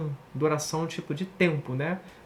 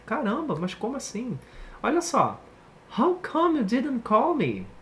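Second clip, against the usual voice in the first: incredulous complaint; 6 semitones higher than usual. Acted. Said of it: "Você tá assim".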